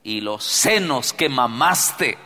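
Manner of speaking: woman crying out loud and high. Acted. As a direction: call out praise from the crowd. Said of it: "levantó la voz y le dijo".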